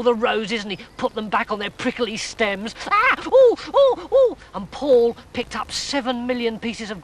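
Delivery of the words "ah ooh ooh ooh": pained high yelps. Acted. Toads straining at thorny roses.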